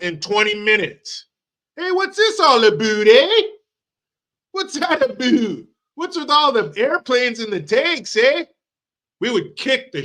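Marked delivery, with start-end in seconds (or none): 1.8-8.4 high, squeaky mock Canadian with eh; much higher hammed-up Canadian surprise. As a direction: caricature Canadian noticing the tanks. Mocking Canadians under attack.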